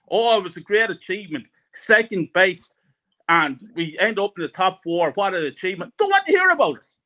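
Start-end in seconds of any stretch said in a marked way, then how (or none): 0.1-5.9 mocking fans. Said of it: "And I don't want to hear".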